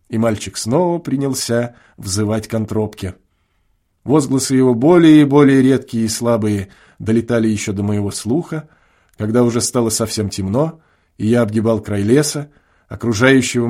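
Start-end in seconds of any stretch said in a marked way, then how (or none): none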